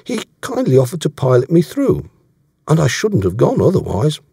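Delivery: earnest, dogged character voice; Davies, dogged. Acted. Voicing Davies in dialogue.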